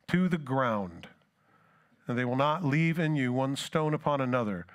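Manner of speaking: preacher reading Jesus' lament; scripture reading. Acted. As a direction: read the lament to the congregation. Reads Luke passage aloud.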